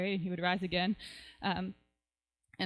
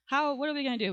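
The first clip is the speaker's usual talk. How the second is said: higher-pitched, acted worried question; a touch of mock desperation; mildly distraught; 5 semitones higher, 3 dB louder than usual. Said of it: "and they're really distraught".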